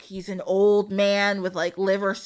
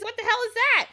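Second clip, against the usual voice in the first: high, louder alarmed cry; frightened princess; 13 semitones higher, 3 dB louder than usual. Acted. Princess asking about the zombie, high alarmed yelp.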